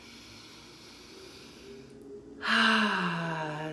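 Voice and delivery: long, voiced "ahh" on the out-breath; cleansing sigh. Shown as a demonstration. Leading listeners in cleansing breaths, long audible sigh. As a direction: exhale audibly, letting it fall away. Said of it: "like I'm doing, feel free to make noise".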